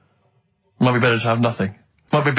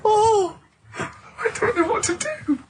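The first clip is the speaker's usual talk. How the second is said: high, soft, slow words squeezed through laughter; helpless laughter; 3 dB quieter, much slower than usual. Real, not acted. Laughing helplessly at the co-host's awful clue. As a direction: laugh so hard you can barely speak.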